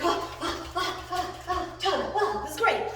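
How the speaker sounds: high, puffing overjoyed dog voice; excited dog. Retold or imitated. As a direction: voice the dog's giddy excitement in little bursts. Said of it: "He's like".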